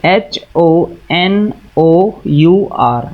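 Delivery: each letter spelled out very slowly; slow spelling out. Shown as a demonstration. Teacher spells a word on the board for the class, very slowly. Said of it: "यहाँ पर लिखा है मैंने".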